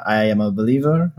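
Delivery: usual speaking voice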